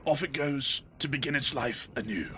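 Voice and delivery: measured British naturalist voice; David Attenborough. Acted. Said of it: "my best David Attenborough voice".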